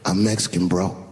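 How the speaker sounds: low, laid-back reply; cool, unbothered. Retold or imitated. Husband cool about the question, answering unbothered. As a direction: say it casually, totally unfazed. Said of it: "her husband was just cool".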